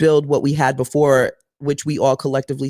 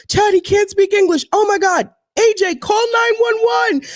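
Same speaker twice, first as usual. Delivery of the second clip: high, loud, urgent calling out; panicked shout; 3 dB louder than usual. Retold or imitated. Calling to Chad about the stranded driver, a high panicked shout.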